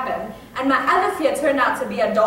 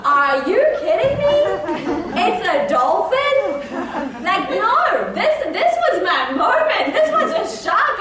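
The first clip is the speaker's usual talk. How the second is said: high, loud comic outrage; indignant disbelief; 7 semitones higher, 4 dB louder than usual. Retold or imitated. Sister learns shark was only a dolphin, hammed-up indignation. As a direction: protest as if robbed of a big moment.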